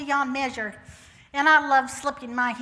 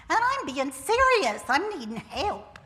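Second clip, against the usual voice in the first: ordinary talk, nothing marked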